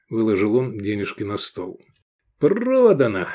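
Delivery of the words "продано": high, satisfied close of a deal. A narrator's reading. Seller raking in the payment, satisfied. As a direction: clinch the sale with relish. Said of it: "сказал с удовлетворением".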